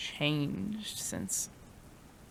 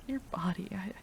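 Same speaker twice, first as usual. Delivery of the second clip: normal talking voice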